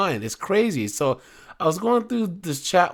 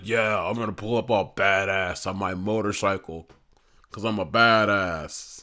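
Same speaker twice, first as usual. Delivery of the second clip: a lightly voiced, lower cocky biker boast; mock tough biker; 6 semitones lower than usual. Mocking the predator as he brags about his motorcycle, lightly voiced lower tough guy. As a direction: puff up like a wannabe tough guy, tongue in cheek. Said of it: "This guy's like".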